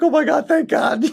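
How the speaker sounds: high, squeaky mock relief; huge relief. Husband hearing his wife, high relieved squeal. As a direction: let the relief burst out high. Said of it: "I was like".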